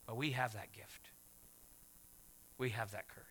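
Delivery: hushed whispered reminder. Acted. Preacher whispering a reminder of faith to the congregation. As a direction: lean in and whisper it.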